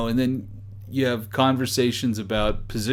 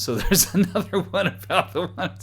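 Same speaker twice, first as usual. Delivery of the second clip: laughing while reading; 7 semitones higher than usual. Real, not acted.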